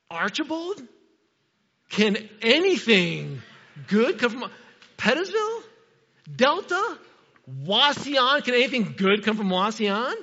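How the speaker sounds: high, loud, incredulous town-naming; mock disbelief. Preacher teasing congregation about local towns, mock disbelief. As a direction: pitch each question up in playful scorn.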